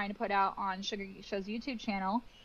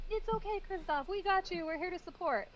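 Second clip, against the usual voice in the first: reassuring; 9 semitones higher than usual. Retold or imitated. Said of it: "We're like".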